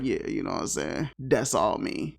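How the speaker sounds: lightly voiced low, soft boast; smug dog. Dog boasting to the owners about his date, low-key smug. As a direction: brag coolly, dropped low and quiet. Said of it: "he overlooking like".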